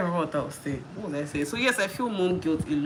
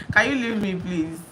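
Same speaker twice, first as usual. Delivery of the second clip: ordinary delivery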